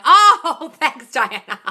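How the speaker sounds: loud, high, drawn-out gush; touched delight. Real, not acted. Coach hears a viewer's nine-year-old loves her, loudly delighted. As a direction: let out a big delighted coo.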